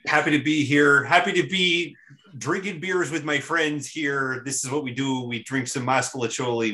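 hammed-up Chicago local's accent; terrible Chicago accent. Introduced guest plays the beer-drinking local in a mock Chicago accent. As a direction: do a badly faked Chicago accent. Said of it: "that's my terrible Chicago accent".

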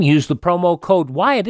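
normal talking voice

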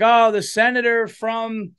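a hint of a pompous introduction; announcer introducing senator. Crowd announcer presenting the senator, lightly mocked. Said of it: "they were like".

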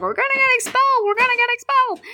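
high-pitched, rapid mock panic; panicking Hermione. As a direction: squeal it in an exaggerated fluster.

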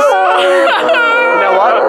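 high, loud, drawn-out yell; exasperated yell. Acted. Calling an end to the bit with a loud high wail.

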